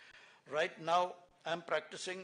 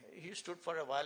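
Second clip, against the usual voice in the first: usual speaking voice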